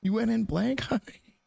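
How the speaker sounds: high, amused disbelief; shocked disbelief. Real, not acted. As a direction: repeat it in stunned delight. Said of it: "Okay, I'll stop screaming".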